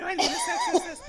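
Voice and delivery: high laughing squeal; woman laughing at her friend's joke, squealing high. Real, not acted. Laughing agreement.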